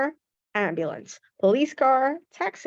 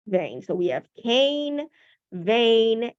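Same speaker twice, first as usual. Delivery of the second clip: ordinary talk, nothing marked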